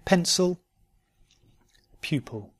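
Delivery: "Pencil" ending in "-sul", "Pupil" in "-pul"; weak I said "uh" in "Pencil" and "Pupil"; careful model words, I reduced to schwa. Shown as a demonstration. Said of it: "I'll just say those again".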